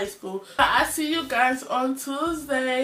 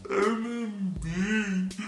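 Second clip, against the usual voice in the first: dazed mumble; much slower than usual. Retold or imitated. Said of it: "I was like".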